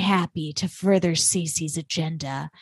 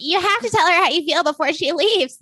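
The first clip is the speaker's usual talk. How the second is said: high put-on Southern-belle voice; a voice that keeps turning into Laura Lee; 10 semitones higher than usual. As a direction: pitch way up into a breathy-sweet Southern lady. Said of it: "it's turning Laura Lee again".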